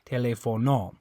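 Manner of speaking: TELÉFONO wrongly as te-le-fo-NO; TELÉFONO with stress moved to NO; stress on last syllable. Shown as a demonstration. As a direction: hit the final O of TELÉFONO. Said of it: "if you're stressing this O".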